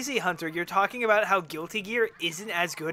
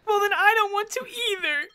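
mock whiny argument; 7 semitones higher, 6 dB louder, faster than usual. Mock quarrel with friend.

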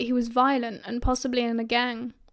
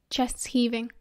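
plain reading voice

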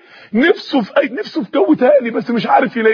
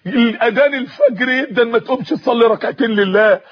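ordinary delivery, nothing marked

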